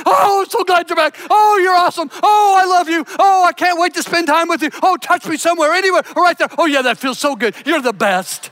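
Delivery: high, loud gushing dog voice; over-the-top happy dog, shouting its love, ending in a laugh; overjoyed dog. Retold or imitated. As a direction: gush like a dog whose owners just came home. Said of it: "The dog is like".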